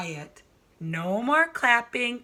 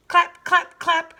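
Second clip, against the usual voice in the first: loud clapping sound; 7 semitones higher, 7 dB louder than usual. A narrator's reading. Sound effect read loudly.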